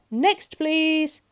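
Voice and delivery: loud, high call; shouted dismissal; booming butcher. A narrator's reading. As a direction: boom for the next customer. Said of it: "boomed the butcher".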